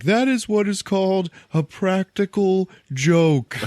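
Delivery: high, slow, emphatic declaration; acted gleeful crowing. Drax laughing at the scared Mantis, high crowing mock explanation. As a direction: proclaim it in hammed-up delight.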